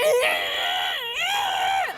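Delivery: loud, very high animal shriek; Tasmanian devil screech. Shown as a demonstration. Actress demonstrating the noise Tasmanian devils make, shrieking. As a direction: screech like an aggressive wild animal. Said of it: "really strange noise, like a".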